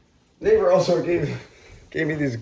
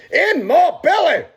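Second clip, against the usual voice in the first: high, loud, drawn-out hammy shout; gleeful food cry; 9 dB louder, slower than usual. Pretend. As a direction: belt it out like a hungry cartoon glutton. Mock-gleeful food exclamation.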